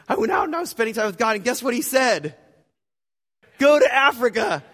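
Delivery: high, loud, fast, building to a shout; breathless excited news. Retold or imitated. Friend running up to share God's answer, thrilled. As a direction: burst out with the news, top of the voice on the punchline.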